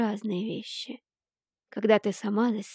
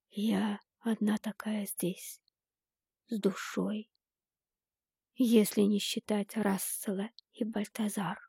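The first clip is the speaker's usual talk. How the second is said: whispered secret; 4 dB quieter than usual. A narrator's reading.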